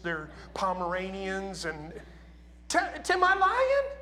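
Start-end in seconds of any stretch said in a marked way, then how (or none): 2.7-4.0 calling out. Real, not acted. Calling out to Tim.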